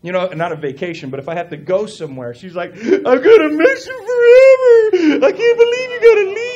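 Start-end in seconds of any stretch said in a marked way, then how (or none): 2.8-6.5 very high, loud exaggerated wail; Sophie. Imitating his daughter before dad leaves, tearful wailing. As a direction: mimic a distraught daughter bawling dramatically. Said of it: "the lead up to a vacation for Sophie is horrible".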